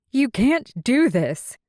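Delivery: loud, high, outraged cry; shouted protest. Acted. Callie shouting at Kelsey before the nobles, loud and high. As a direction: shout it at the crowd in disbelief. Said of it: "Callie shouted".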